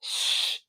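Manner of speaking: long voiceless shush; hushing shush. Retold or imitated. Viewer hushing a chattering castmate, mock shushing. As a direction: shush her like she should stop.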